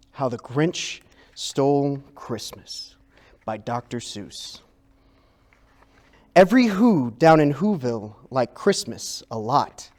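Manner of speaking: usual speaking voice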